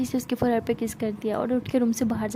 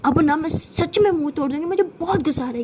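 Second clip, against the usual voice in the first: louder, higher angry threat; angry outburst; 4 semitones higher, 5 dB louder than usual. Acted. Mahi fed up with Sana's teasing, angry and loud. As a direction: snap back loud at the teasing.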